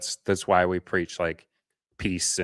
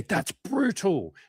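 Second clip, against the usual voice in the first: much higher, very slow, drawn-out groan of "brutal"; suffering lament; 12 semitones higher, much slower than usual. Shown as a demonstration. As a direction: stretch "That's brutal" out like you're in agony. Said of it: "But if we say".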